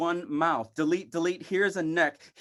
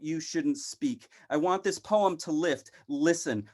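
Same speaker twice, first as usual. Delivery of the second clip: ordinary talk, nothing marked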